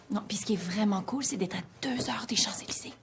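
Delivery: hushed secret whisper. Acted. Whispering to her friend while sneaking out. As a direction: breathe it out so no one hears.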